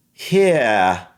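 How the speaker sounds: British "Here" ending in an open vowel; British accent. Shown as a demonstration. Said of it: "And for British".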